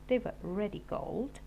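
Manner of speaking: plain reading voice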